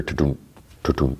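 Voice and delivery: soft, low mouthed heartbeat, tudum tudum; imitated heartbeat. Recapping a show scene, softly mimicking a heartbeat. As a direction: hum a quiet heartbeat thump. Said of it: "listening to his, like, heartbeat".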